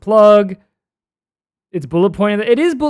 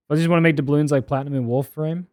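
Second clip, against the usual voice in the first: ordinary delivery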